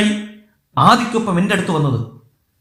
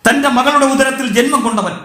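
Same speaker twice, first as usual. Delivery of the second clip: ordinary delivery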